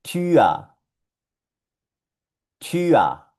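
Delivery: Tür said "tü-a", no R heard; German pronunciation demo. Shown as a demonstration. Teacher showing how the word is pronounced, "Tua" with rounded ü. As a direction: model Tür clearly, ü falling into A. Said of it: "Se pronuncia".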